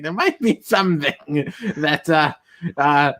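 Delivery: slow, drawn-out imitation of his accent; a lightly done Vincent Price accent. Friend imitating the actor, slow Vincent Price accent. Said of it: "I'm trying to do his accent".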